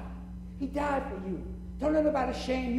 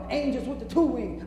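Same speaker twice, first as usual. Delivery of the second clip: ordinary talk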